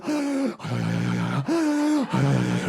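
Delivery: acted panicked gasps with voiced exhale; panicky gasping. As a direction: gasp in and out as if panicking. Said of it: "doing the panicky breathing thing".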